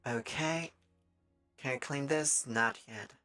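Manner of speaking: usual speaking voice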